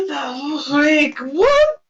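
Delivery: shocked shout. Real, not acted. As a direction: blurt it out in disbelief. Player shocked outburst.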